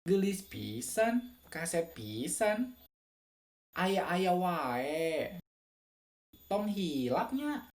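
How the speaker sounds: drawn-out, swinging Sundanese lilt; Sundanese accent. Shown as a demonstration. Listing Sundanese phrases for viewers, slow lilting Sundanese accent. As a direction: stretch the vowels and ride the Sundanese melody. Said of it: "yang memang orang Sunda banget".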